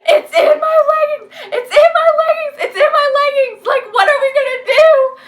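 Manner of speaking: high, loud panicked shrieking with a sob in it; panicked scream. Retold or imitated. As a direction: scream it in terror, half laughing, half crying. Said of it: "screaming bloody murder for him to come help".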